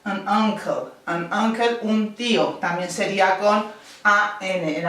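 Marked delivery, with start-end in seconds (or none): 0.1-1.8 "an uncle" linked, said twice. Shown as a demonstration. Teacher models an uncle for the class. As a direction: link an into uncle. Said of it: "entonces sería an umbrella".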